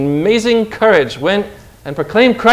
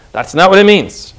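ordinary talk, nothing marked

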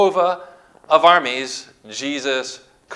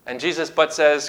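ordinary delivery

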